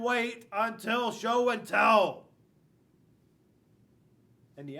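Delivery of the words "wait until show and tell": louder, slowed-down command to the alligator; stern order. Acted. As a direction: bark it out slowly like a strict teacher. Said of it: "order the alligator to be good".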